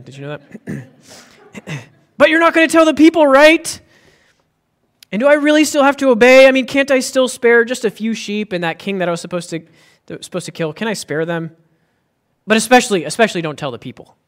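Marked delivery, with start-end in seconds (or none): 2.2-11.6 high, fast whiny excuse voice, much louder; mocking King Saul. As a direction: whine like a guilty child bargaining his way out. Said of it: "They had accents back then".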